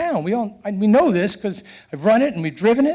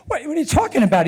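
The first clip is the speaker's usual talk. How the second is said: higher, fast, startled protest; shocked disbelief; 6 semitones higher, faster than usual. Retold or imitated. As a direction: blurt it out in disbelief. Told he's pulled from race.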